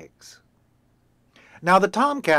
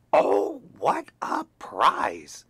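delighted exclamation; 3 dB louder, much slower than usual. Acted. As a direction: gasp at a lucky find. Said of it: "she exclaimed".

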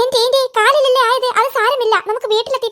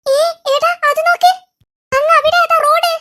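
excited spotting; 6 semitones higher than usual. Acted. Spotting the road ahead.

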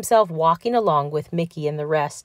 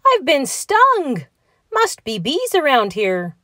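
pained cry; 8 semitones higher than usual. A narrator's reading. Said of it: "cried Donald Duck".

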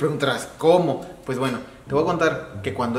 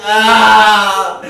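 straining shout; 6 semitones higher, 11 dB louder, slower than usual. Acted. Straining to pull tooth.

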